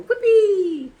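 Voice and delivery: high, loud gleeful whoop; brain going whoopee. Pretend. Vlogger voicing her unmedicated brain, gleeful whoop. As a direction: squeal it like a giddy brain. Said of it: "my brain is going".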